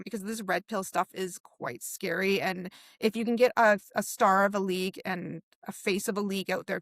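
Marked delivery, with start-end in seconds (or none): none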